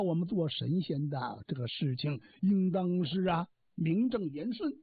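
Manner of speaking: ordinary delivery, nothing marked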